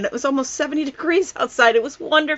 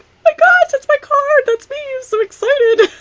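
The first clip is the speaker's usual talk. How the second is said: much higher and louder, squealing with delight; thrilled squeal; 4 dB louder than usual. Retold or imitated. Vlogger retelling seeing her card shared by the CEO, squealing excitedly. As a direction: gush like a fan who just saw her own card. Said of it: "I was like".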